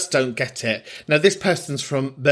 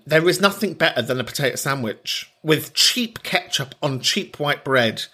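normal talking voice

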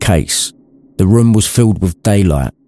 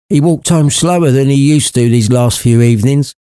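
mother's voice; 7 semitones higher than usual. Acted. Mother speaks of Bob.

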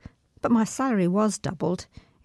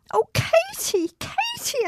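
put-on high, wailing cry of a hurt child; crying out in pain; slower than usual. Child with a crushed toe crying to her sister. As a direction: sob out her sister's name. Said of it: "I cried".

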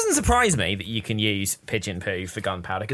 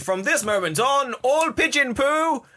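town crier; 13 semitones higher, slower than usual. Pretend. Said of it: "town crier".

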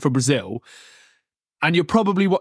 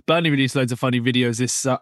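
ordinary delivery

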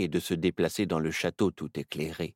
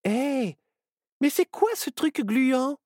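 higher, startled cry at something on the floor; surprised exclamation; 5 dB louder than usual. A narrator's reading. Myrtille finds slime on the floor: higher, startled exclamation. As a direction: spot something gross and exclaim. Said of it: "qui s'exclama".

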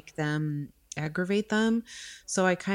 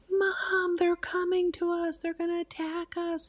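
put-on much higher, panicked little-girl voice; scared daughter screaming; 11 semitones higher than usual. Mother imitating her daughter's panic, high frightened child voice. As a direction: squeal it out like a terrified child. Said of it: "starts screaming and is like".

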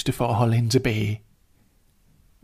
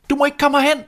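much higher, loud frantic cry; shouting warning; 7 dB louder, faster than usual. Acted. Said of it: "søstrene råbte til hende".

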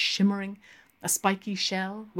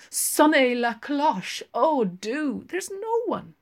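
coaxing; 8 semitones higher than usual. A narrator's reading. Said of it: "urged Lenehan".

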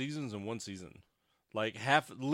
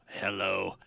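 low, robotic talking-toy voice; Speak & Spell voice; 5 semitones lower than usual. Retold or imitated. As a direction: say it like a machine reading typed text. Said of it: "human version of a speaking spell".

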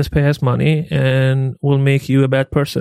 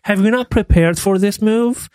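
exasperated; 8 semitones higher than usual. Retold or imitated. Outraged quote at rude movers.

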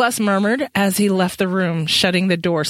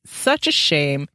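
slow, mock-sorry sigh of a line; mocking sympathy; 6 semitones lower, much slower than usual. Benjamin mocking Matthew about the dead witch, slow mock sympathy. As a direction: drag it out in fake pity. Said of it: "made a sound of mocking sympathy".

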